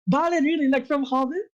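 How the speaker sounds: high-pitched, incredulous question; amazed disbelief. Real, not acted. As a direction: ask as if you can't believe the name. Player recognizes name from Hobbit.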